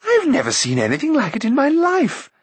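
awed prince. A narrator's reading. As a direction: gush with amazement. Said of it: "exclaimed the prince".

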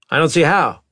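stern captain voice. Acted. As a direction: voice the captain with authority. Maddox voiced.

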